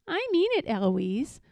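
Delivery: very high, cheeky parroting; mimicking the tutor. A narrator's reading. Eloise right back at her tutor, high and mocking. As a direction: throw it back with a rising end. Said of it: "right back at him".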